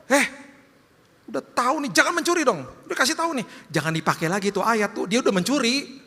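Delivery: high, nagging scold of a judgmental churchgoer; mock scolding. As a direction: lecture the thief with a self-righteous whine.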